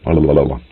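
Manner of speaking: loosen the tongue with a quick la-la-la; playful "la la la" after tripping over words; tongue-twisted babble. Real, not acted. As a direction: run of la's to reset the mouth. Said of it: "Getting all tongue twisted".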